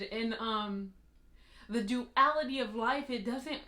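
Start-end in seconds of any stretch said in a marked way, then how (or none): none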